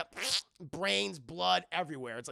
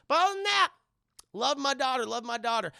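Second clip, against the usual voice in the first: high, loud bright mock cheer; breezy sarcastic brush-off; mock cheerful; 8 semitones higher, 9 dB louder than usual. Sarcastic mock cheer after tragedy.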